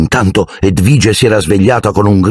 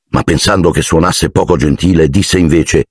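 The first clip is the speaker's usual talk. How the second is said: ordinary delivery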